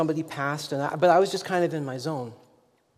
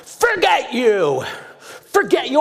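furious shout; 7 semitones higher, 7 dB louder than usual. Retold or imitated. Stranger yelling at a pastor who ignored him, furious and loud. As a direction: let him blow up at the pastor. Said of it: "So he's looking at me and he says".

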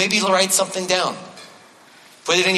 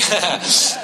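short real laugh. Real, not acted. Laughs at own joke.

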